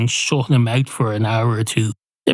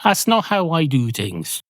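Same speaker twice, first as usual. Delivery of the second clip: ordinary talk, nothing marked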